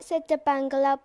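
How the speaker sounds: usual speaking voice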